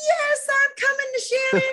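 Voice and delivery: very high, loud happy-dog voice; imitating a thrilled dog, high-pitched; excited dog. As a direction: squeal like an overjoyed dog running over. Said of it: "like, all excited".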